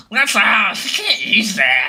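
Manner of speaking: high, loud, slow gremlin screech. Retold or imitated. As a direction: snarl it out like a greedy little gremlin. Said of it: "would a gremlin sound more like".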